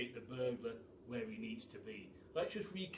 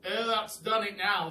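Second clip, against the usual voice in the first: higher, loud dismay; glum pelican; 6 semitones higher, 10 dB louder than usual. A narrator's reading. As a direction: sigh it out like a dejected pelican. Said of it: "said the pelican".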